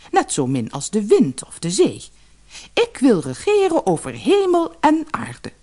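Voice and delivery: high, imperious royal voice; a greedy queen who wants it all; haughty queen. Acted. Queen wife boasts to the fisherman, high and haughty.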